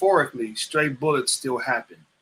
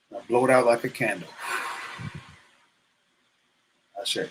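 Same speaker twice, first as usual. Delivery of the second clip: guided meditation; 2 semitones lower than usual. Acted. Leads a breathing exercise.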